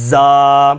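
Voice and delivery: a buzzing, firm زا; firm letter demo. Shown as a demonstration. Teacher models a firm زا. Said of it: "زا کو سختی کے ساتھ".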